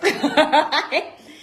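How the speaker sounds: loud cackle at her own line; real laugh. Real, not acted. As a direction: laugh out at the punchline. Laugh after the punchline.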